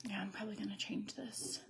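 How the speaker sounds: hushed aside; whisper. Real, not acted. Vlogger murmuring about changing her shirt, whisper. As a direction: whisper it as a half-thought.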